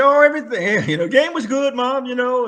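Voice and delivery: high, loud nervous cover. Retold or imitated. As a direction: talk high and fast to cover the lie. Nervous cover story to mother.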